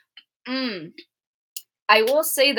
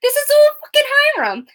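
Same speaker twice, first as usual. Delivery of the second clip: angry realization; 9 semitones higher, 3 dB louder than usual. Retold or imitated. Jughead's realization, voiced angrily.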